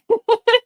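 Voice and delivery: short high loud laugh. Real, not acted. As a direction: burst out in a quick high giggle. Laughs after agreeing on Saturday.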